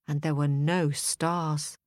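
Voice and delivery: usual speaking voice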